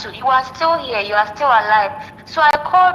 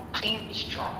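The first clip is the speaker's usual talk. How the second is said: breathy whispered plea; 15 dB quieter than usual. Real, not acted. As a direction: whisper it softly as a last plea. Friend consoling her on call.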